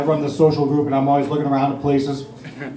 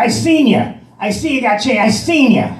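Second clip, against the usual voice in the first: pushy panhandler; 8 semitones higher than usual. Retold or imitated. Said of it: "He said".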